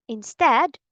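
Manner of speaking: normal talking voice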